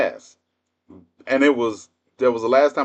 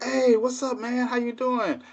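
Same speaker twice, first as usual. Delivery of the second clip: put-on high, light, breezy hello to an old enemy; casual hey man voice, pitched up; mock friendly greeting; 12 semitones higher, 5 dB quieter than usual. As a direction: play the clueless guy greeting him casually. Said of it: "and he's like".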